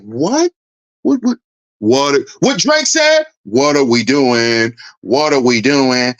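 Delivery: low, slow, laid-back [name]-style drawl; a hint of a lazy [name] drawl; [name] impression. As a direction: play it cool and aloof like [name]. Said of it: "Too much [name] energy".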